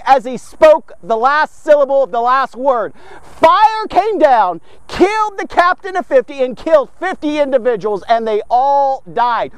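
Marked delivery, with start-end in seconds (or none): none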